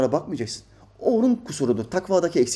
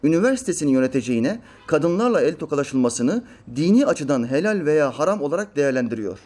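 normal talking voice

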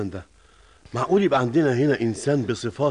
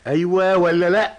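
higher, insistent yes-or-no question; impatient demand; 6 semitones higher, 4 dB louder than usual. Acted. Boss pushing an evasive employee for an answer, impatient demand. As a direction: press hard for an answer.

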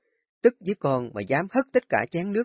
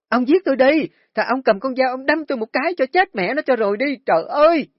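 shrill, much higher and louder hysterical wailing; wailing scream; 13 semitones higher, 9 dB louder than usual. Acted. Wife rolling on the floor wailing at husband, hysterical screaming. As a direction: throw a tantrum, screaming to the heavens. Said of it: "Bà Hoạt vừa hét".

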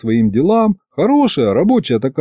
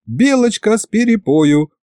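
higher, fawning helpful imp; obsequious; 10 semitones higher than usual. A narrator's reading. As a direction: offer it slyly and eagerly. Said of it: "подобострастно подсказал бес".

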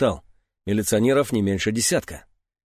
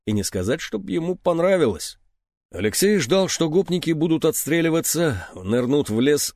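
ordinary delivery

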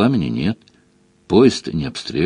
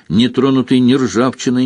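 usual speaking voice

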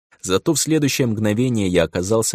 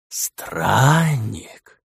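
mocking drawl; 3 semitones higher than usual. A narrator's reading. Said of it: "издевательски протянул". (издевательски протянуто)

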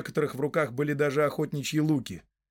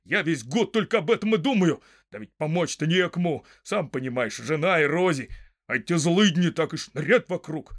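louder, excited pouring-out; eager farmer; 4 semitones higher, 4 dB louder than usual. A narrator's reading. Farmer welcoming the long-awaited uprising, eager and excited. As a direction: speak up with pent-up eagerness.

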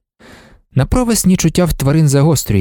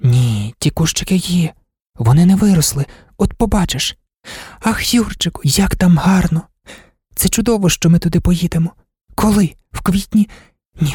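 put-on higher, dreamy girl's voice; eager Marta; 5 semitones higher than usual. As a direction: voice an enthusiastic girl, pitched up.

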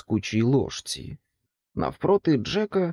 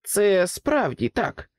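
higher, eager exclamation; pig exclaims; 7 semitones higher, slower than usual. A narrator's reading. Pig hastily agrees with the king, higher and eager. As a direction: blurt it out to please him. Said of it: "вигукнула різдвяна свинка".

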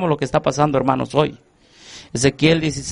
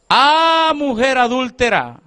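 divine outcry; 7 semitones higher than usual. Acted. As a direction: shout the rebuke up high like God crying out. God rebukes unfaithful wife.

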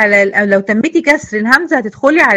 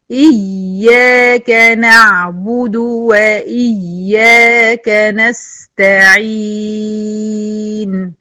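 slow, drawn-out chanted recitation; slow Quran recitation; much slower than usual. Shown as a demonstration. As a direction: recite it in measured tajweed. تلاوة مجودة. (تلاوة مجودة بطيئة)

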